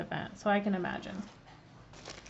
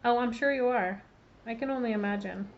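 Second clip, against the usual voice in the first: ordinary delivery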